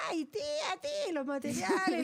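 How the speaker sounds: put-on high, excited child voice calling tía; kids calling out. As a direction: squeal it up like a kid grabbing the teacher.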